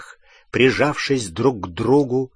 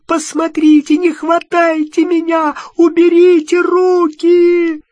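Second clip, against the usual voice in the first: desperate high screaming from afar; panicked pleading shout, much higher; echoing scream; 6 dB louder than usual. Acted. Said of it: "по коридору эхом раскатился крик".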